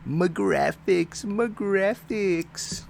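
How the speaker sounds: high, loud mocking tone. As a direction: parrot the phrase in scorn. Parrots a critic's catchphrase.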